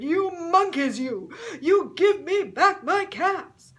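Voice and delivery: high, very loud furious yell; angry shout. Acted. Furious peddler shouting at the monkeys for his caps. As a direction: shout at the monkeys with all you have. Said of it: "stamped both his feet and shouted".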